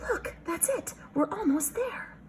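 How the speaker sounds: high, breathless little squeal; excited bat cry. Acted. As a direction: squeal with delight, almost there. Bats arriving, voiced excitedly.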